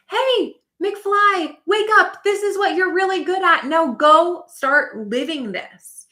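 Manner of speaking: high, loud rousing shout-out; urgent wake-up call. Acted. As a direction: jolt a sleepy friend awake.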